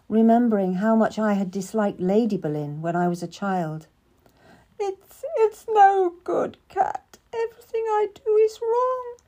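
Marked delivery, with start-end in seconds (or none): 4.8-9.2 crying. A narrator's reading. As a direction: sob out the despair. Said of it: "Lady Jane was crying again".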